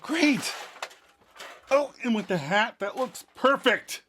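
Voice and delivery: high-pitched acted excitement; hammed-up delight, slowing on the last words; delighted dad. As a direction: exclaim it high, thrilled with your creation. Dad delighted with fake son.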